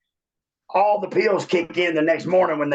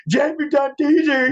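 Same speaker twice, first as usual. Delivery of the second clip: excited greeting; 10 semitones higher, slower than usual. Retold or imitated. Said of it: "and they're like".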